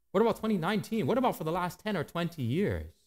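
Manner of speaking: ordinary delivery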